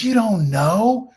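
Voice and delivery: high, slow, disbelieving repeat; incredulous boss. Retold or imitated. Boss Bob scoffs at employee who doesn't know, high incredulous. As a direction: stretch it out in loud disbelief. Bob's incredulous retort retold.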